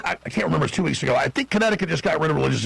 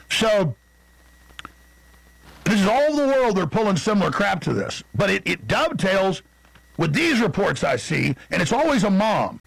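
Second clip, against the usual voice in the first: ordinary talk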